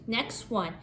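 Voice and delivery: ordinary talk, nothing marked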